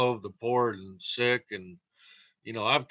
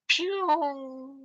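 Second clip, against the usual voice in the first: much higher zapping pew; laser pew; 14 semitones higher, 3 dB quieter than usual. Pretend. To viewers, imitating a laser zap sound. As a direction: fire a cartoon laser.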